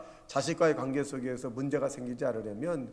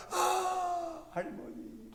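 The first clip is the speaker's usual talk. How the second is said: imitated crying child; much slower than usual. Child turning to comforting grandmother, high imitated wailing. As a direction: pretend to bawl for Grandma. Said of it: "울음이 뚝 굳은 거예요".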